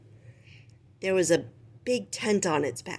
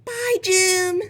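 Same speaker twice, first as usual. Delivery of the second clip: much higher squeaky farewell; chirpy rat; 12 semitones higher, 4 dB louder than usual. Acted. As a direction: wave him off cheerfully. Rizzo waves from the deck.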